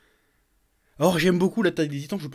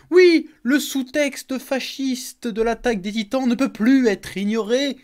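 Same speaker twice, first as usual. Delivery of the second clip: slow, higher and louder, overly grave; tragic tone; 7 semitones higher, 5 dB louder, slower than usual. Shown as a demonstration. YouTuber translating the title for viewers, mock-tragic and slow. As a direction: declaim it like a tragedy, hamming up the gravity. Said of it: "le côté tragédie".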